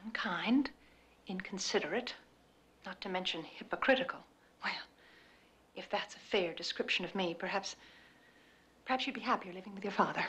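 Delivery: soft wounded voice; hurt and quiet. Acted. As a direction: speak low, stung by the words. Hurt mother turns quiet.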